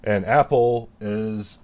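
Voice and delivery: ordinary talk, nothing marked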